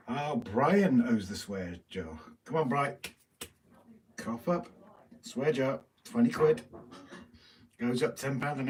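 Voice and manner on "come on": usual speaking voice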